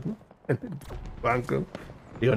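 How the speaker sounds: usual speaking voice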